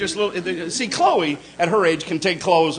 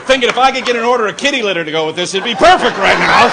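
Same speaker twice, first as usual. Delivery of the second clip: mock snooty wine taster; 3 semitones higher, 7 dB louder than usual. Comic voicing a snooty taster.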